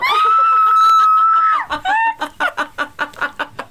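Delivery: very high screaming laughter; shrieking laugh. Real, not acted. Fart on the head.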